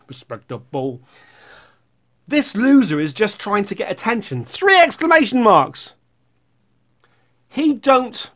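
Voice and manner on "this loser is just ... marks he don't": high, loud comment-reading; mock indignant reading. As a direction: exclaim the insult theatrically. Reads an insulting comment aloud.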